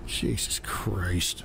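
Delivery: very low, slow, hushed sigh of words; weary exasperation. Real, not acted. Streamer worn down by his chat, weary low mutter. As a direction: let it out under your breath, drained.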